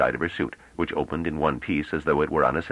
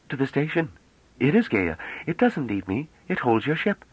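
put-on a young woman's higher voice, teasing; playful young woman; 9 semitones higher than usual.

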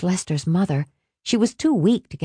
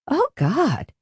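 ecstatic; 8 semitones higher than usual. Acted. Ecstatic exclamation.

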